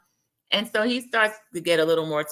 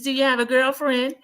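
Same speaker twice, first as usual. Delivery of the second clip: higher put-on asking voice; imitated prying question; 7 semitones higher, 3 dB louder than usual. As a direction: lift it high, imitating her question. Voices her teasing question.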